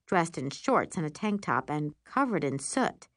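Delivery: plain reading voice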